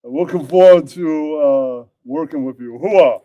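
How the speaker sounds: loud gung-ho hoo-ah voice; Al Pacino. Acted. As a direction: boom it like Pacino. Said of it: "says Al Pacino".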